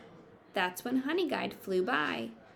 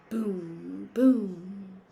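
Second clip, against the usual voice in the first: stomp it out; booming stomps; 6 dB louder than usual. Acted. Sound word in picture book.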